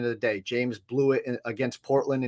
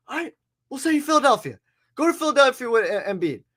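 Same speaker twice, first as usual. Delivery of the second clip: mock front office voice; 9 semitones higher than usual.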